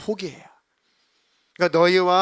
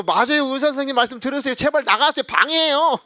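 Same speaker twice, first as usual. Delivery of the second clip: higher, louder mock nurse; nurse scolding; 8 semitones higher, 6 dB louder than usual. Nurse telling husband to obey the doctor, brisk high scolding. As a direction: shoo him out briskly.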